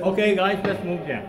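rally the group to move along; real call-out to a tour party. Real, not acted. Guide calls group to move on.

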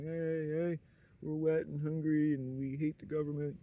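high, loud mock call of street people; mock homeless plea. A loud, high mock plea to passing drivers. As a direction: yell it up high like a taunting beggar.

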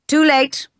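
high, loud cry waving them off; a loud, bitter rebuke. A narrator's reading. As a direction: cry it out to the crowd. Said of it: "cried the master".

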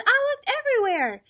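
high, fast, frantic reply; exasperated farmer. A narrator's reading. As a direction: rush it out in a high voice. Farmer cannot find turkey.

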